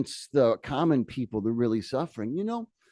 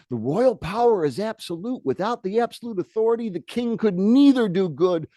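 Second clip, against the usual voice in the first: pompous bishop; 6 semitones higher than usual. Retold or imitated. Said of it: "This is one of the king's advisors".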